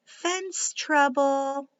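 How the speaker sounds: ordinary delivery, nothing marked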